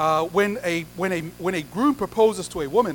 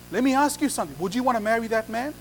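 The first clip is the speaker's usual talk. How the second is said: ordinary delivery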